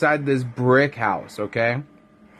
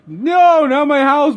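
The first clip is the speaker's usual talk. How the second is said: high, loud squeal of panic; a pig wailing over its lost house; panicked pig; 13 semitones higher, 6 dB louder than usual. Acted. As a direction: cry out as your house falls.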